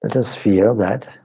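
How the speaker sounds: ordinary talk, nothing marked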